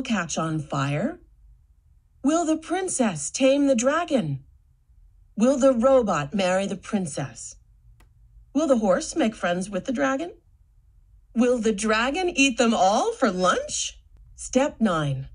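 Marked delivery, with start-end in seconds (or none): none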